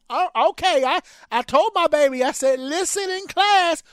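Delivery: high, loud sassy grandma; loud, high, mock-stern grandma. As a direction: belt it out like a fed-up grandmother. Grandparent voiced in story.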